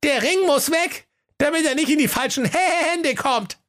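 put-on very high goat-kid voice, matter-of-fact; little goats. A narrator's reading. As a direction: speak like sensible little goats. (hohe Geißleinstimme, vernünftig)

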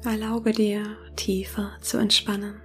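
soft spa whisper. Acted. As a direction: whisper gently, guiding them into calm. Leitet eine Meditation. (sanftes Meditationsflüstern)

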